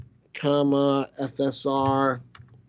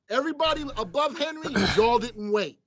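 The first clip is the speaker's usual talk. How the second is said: scolding; 7 semitones higher than usual. Real, not acted. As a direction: call out the ones who didn't wait. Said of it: "Joey's gonna say that I'm yelling".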